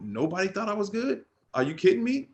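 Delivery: are you kidding me, high and indignant; mock-outraged disbelief; incredulous outrage. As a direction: pitch jumps way up in disbelief. Outraged at going undrafted.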